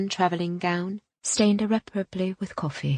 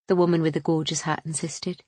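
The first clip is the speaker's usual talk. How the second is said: ordinary delivery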